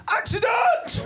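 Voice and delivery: very high stretched-out yell; comic high-pitched shout. Retold or imitated. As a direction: holler it high, dragging out the word. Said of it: "So I shouted".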